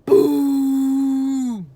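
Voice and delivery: loud held tuba-like bellow; dinosaur bellow. A narrator's reading. Duck-billed dinosaur bellowing over Annie, loud creature call. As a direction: bellow like a huge beast.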